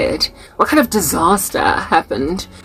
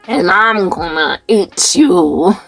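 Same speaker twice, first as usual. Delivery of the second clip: low, slow menacing slasher voice; Freddy Krueger; 2 semitones lower, much slower than usual. Retold or imitated. Mocking a sinner who terrifies the earth, slow horror-villain voice. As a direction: creep them out like a horror villain. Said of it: "you're Freddy Krueger".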